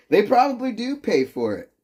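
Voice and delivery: ordinary delivery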